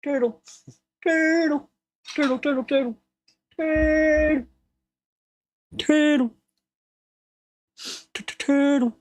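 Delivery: high silly repeated chant; high squeaky movie voice. Retold or imitated. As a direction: squeak it like a goofy film character. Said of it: "he starts going".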